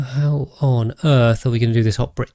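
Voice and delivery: ordinary talk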